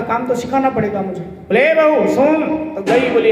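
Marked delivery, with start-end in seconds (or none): 1.6-2.4 slightly raised, calling tone; a hint of a mother-in-law calling; summoning mother-in-law. Mother-in-law calls the new bride to her, summoning.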